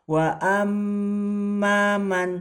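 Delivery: wa ammaman with mm held long, slow and high; held doubled m. Shown as a demonstration. Teacher demonstrating to students, mm held. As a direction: hold the mm of ammaman. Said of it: "Nah, ini juga".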